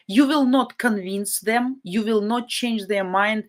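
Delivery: ordinary delivery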